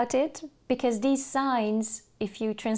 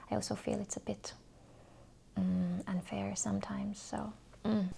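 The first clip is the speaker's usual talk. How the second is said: trailing into a whisper; 10 dB quieter than usual. Real, not acted. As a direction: voice sinks to a whisper at the end. Confiding a mixed feeling softly.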